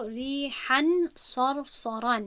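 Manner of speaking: drawn-out, careful recitation of ریحاً صرصراً; slow Quranic recitation. Shown as a demonstration. Teacher reciting an example word slowly for the students.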